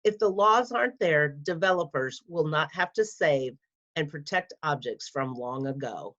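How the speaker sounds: ordinary delivery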